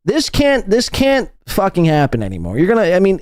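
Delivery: normal talking voice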